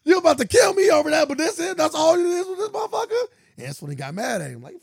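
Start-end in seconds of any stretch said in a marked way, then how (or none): 0.1-3.2 high, loud mock protest; hammed-up outraged shout. Retold son protests being blamed, high indignant shouting. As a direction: holler in imitated disbelief and indignation. Acting outraged film character.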